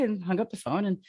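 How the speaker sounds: ordinary talk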